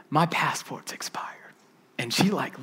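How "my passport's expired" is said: slow, sheepish whisper; husband whispering a confession to his wife. Retold or imitated. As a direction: whisper the bad news.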